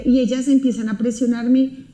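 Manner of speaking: ordinary delivery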